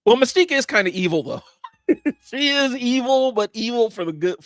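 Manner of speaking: high, loud, laughing concession; amused admission breaking into a giggle; real amusement. Real, not acted. Laughs while conceding Mystique is evil.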